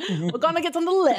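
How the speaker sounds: badly faked British accent; hammed-up British with an Irish slip. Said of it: "That sounded more Irish".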